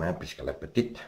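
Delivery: ordinary delivery, nothing marked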